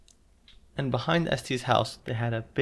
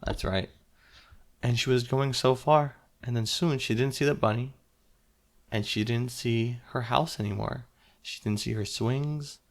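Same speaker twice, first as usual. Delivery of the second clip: ordinary delivery, nothing marked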